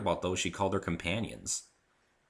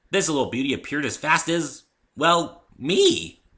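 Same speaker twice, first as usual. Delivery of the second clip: higher and louder, smirking brag; smug pride; 8 semitones higher, 8 dB louder than usual. Acted. Rainbow Dash smirking proudly about her cutie mark. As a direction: let the smirk into the voice. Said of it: "she smirked proudly".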